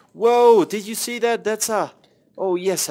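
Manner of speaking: high, loud thrilled whoa; excited shout. Real, not acted. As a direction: burst out at the lucky find. Spots a rare drop in game.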